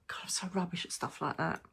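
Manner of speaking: fast hushed aside; whisper. Real, not acted. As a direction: tut at yourself in a whisper. Self-deprecating aside.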